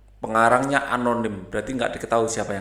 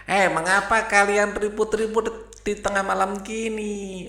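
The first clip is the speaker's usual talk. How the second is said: high owl voice scolding; 8 semitones higher than usual. Acted. Owl scolds the noisy hens at midnight, high-pitched. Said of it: "ini yang ngomong burung hantu ya".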